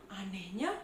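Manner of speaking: usual speaking voice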